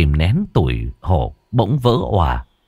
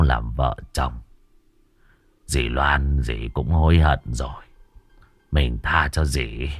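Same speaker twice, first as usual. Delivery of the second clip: quiet prayer; 5 semitones lower, 3 dB quieter than usual. Acted. The husband prays softly to his dead wife at her altar. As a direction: murmur a tender prayer at the altar. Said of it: "miệng khấn vái".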